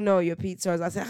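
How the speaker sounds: ordinary talk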